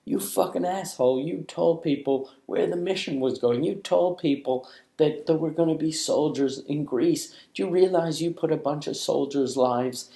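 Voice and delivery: high, mocking furious scold; angry email. Army officer scolding him by email, high mock fury. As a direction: rant at him like an outraged officer. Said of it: "that was like".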